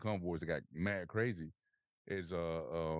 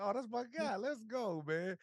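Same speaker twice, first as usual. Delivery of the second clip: much higher, gleeful hyped-up cheer; thrilled. Retold or imitated. Soldier retelling joy at his new leader, high gleeful cheer. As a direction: squeal it like you just got great news. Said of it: "I was like".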